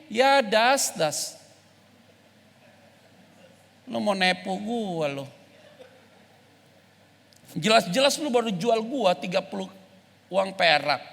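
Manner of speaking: low, quiet, slow street-talk reproach; quiet blunt accusation. Pretend. Jesus calling out Judas directly, low and quiet. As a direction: confront him under your breath, man to man. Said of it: "Yesus harusnya bisa ngomong gini".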